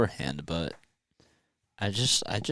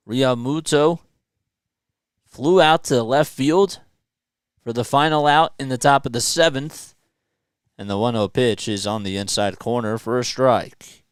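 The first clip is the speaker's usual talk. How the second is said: play-by-play; 2 semitones higher, 5 dB louder than usual. Acted. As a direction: announce each pitch like a broadcaster. Live play-by-play call.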